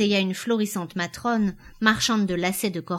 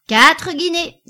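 high, much louder auction cry; shouted bid; 9 semitones higher, 10 dB louder, slower than usual. A narrator's reading. Auctioneer crying the new price, shouting. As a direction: holler the price to the back. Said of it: "cria le commissaire priseur".

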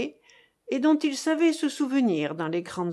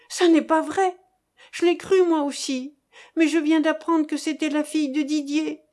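higher, louder, urgent protest; crying out; 6 semitones higher, 4 dB louder than usual. A narrator's reading. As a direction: cry it out as a desperate denial. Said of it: "Françoise poussa un cri". (cri de protestation)

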